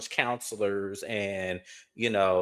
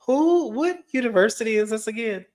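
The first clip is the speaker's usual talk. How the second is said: high and louder, disbelieving; shocked, can't believe it; incredulous; 11 semitones higher, 4 dB louder than usual. Real, not acted. Incredulous question amid laughter.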